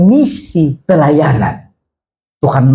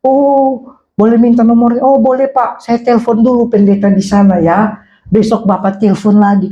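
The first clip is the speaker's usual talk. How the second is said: higher, polite phone voices; lightly acted phone exchange; 6 semitones higher than usual. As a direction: lightly play both ends of a call. Acts out both sides of phone call. (percakapan telepon, nada agak tinggi)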